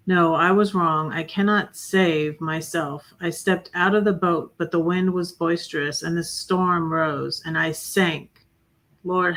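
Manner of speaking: defiant, forceful recital of a confession; poem reading. Acted. She reads her divorce poem, defiant then prayerful. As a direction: read it as a fierce, prayerful declaration.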